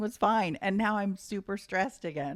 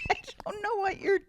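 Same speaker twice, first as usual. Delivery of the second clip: exasperated shout; 13 semitones higher than usual. Retold or imitated. Exasperated quote of herself.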